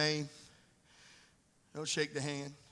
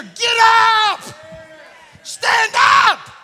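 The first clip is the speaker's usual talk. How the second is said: high, loud pulpit cry; shouted command; 9 semitones higher, 11 dB louder, much slower than usual. Acted. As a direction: rouse them to their feet. Preacher commanding congregation.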